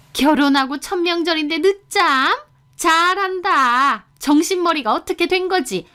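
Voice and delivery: high-pitched, scathing phone tirade; yelling mother-in-law. A narrator's reading. Mother-in-law berates daughter-in-law on first holiday, angry high scolding. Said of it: "하면서 소리를 지르시는데".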